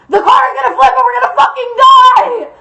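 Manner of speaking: high, loud screaming panic; panicked yelling. Acted. Storyteller gets across the drifting terror, panicked yell. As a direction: yell it as if the car is flipping. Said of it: "I'm sorry for yelling".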